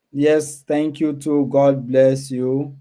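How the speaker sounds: ordinary talk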